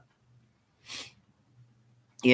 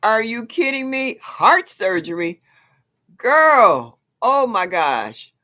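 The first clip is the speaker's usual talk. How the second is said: high, loud, stunned disbelief; shocked surprise; 8 semitones higher, 7 dB louder, slower than usual. Real, not acted. Host hearing a viewer had heart surgery, loudly stunned. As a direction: react like the news just hit you.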